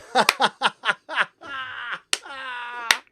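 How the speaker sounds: high, wheezing real laugh; a real laugh that breaks into a cry; hearty laugh. Real, not acted. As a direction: laugh until it nearly turns to crying. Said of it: "That was good".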